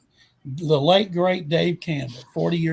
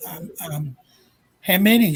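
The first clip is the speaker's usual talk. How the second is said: retold stunned stammer, then louder question; in shock; 3 dB louder than usual. Retelling the phone call about being voted in, stunned. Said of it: "in shock".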